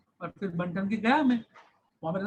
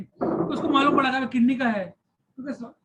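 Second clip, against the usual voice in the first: usual speaking voice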